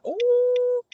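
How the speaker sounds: sudden high stretched ooh; startled ooh. Real, not acted. Catching herself mid-sentence, drawn-out high ooh.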